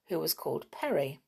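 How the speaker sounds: plain reading voice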